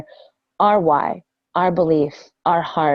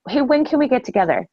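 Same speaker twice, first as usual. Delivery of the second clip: higher and quicker, a bright closing question on "when"; upbeat direct ask; 6 semitones higher, faster than usual. Shown as a demonstration. Coach showing recruits how to ask for the meeting, upbeat and direct. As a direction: push for a yes with a lively, quick "Hey, when can we get together?". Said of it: "you're asking for them to make a decision".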